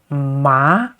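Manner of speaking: "ma" starting low and going up; rising, question-like "ma"; second tone. Shown as a demonstration. Teacher giving the word for hemp, rising "ma". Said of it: "and it goes up".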